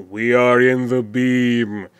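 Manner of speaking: a hint of a slow synthetic voice; text-to-speech voice. Map maker mimicking Watergate's announcer, slow text-to-speech voice. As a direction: lightly imitate text-to-speech, drawn out. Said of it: "text-to-speech thing going on".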